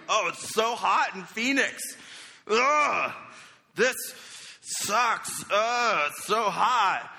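hammed-up whine about the heat, slow; fake complaining. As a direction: moan as if suffering in Phoenix. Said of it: "I'm like".

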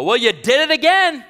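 high, loud teasing voice of a congregant; Scott's voice. Retold or imitated. Pastor imitates churchgoer Scott teasing him, high and loud. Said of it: "he'll come out often and go".

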